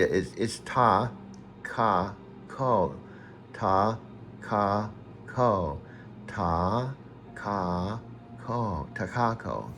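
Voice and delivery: ta, ka, ko softening into Takako; syllable by syllable. Shown as a demonstration. Correcting a friend's pronunciation, slow syllables. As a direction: patiently spell out Takako. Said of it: "something like Takako".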